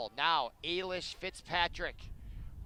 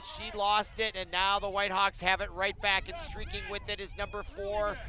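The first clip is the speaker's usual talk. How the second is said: higher, louder turnover call; excited play-by-play; 4 semitones higher, 5 dB louder than usual. Acted. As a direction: call the turnover with rising energy.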